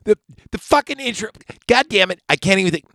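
normal talking voice

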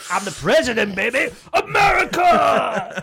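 loud, high presidential shout; Obama impression. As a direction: bellow it like a hyped president.

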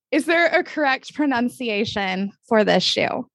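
ordinary talk, nothing marked

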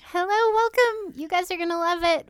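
high, eager host welcome; excited welcome. Real, not acted. Host welcomes listeners to today's guest show, excited welcome.

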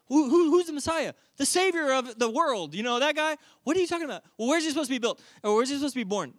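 high, flustered interrogation; agitated questioning. Acted. Herod quizzing the rulers about the Messiah, agitated. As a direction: pepper them with incredulous high-pitched questions.

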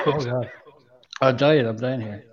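choked, recovering from coughing; strained after a coughing fit; swallowed wrong way. Real, not acted. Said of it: "The Caramello went down the wrong way".

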